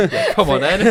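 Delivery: high, loud, laughing dare. Real, not acted. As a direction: laugh out a mock come on then. Mock challenge, laughing.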